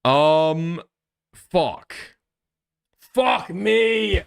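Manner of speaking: frustrated cry of fuck me; exasperated outburst. Real, not acted. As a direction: burst out in exasperation. Frustrated outburst reading messages.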